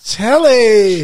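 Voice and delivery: hyping up the producer; loud announcer intro. Acted. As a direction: present her like a game show host.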